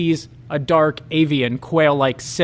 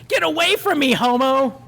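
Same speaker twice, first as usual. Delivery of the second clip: much higher, recoiling sneer; tied-up bully rejecting the hero; disgusted bully; 8 semitones higher than usual. Acted. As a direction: shove him off with your voice. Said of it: "Roger says".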